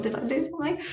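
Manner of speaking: high, anxious little question; worried. Retold or imitated. Herself wondering what she did, high and worried. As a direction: fret you caused it.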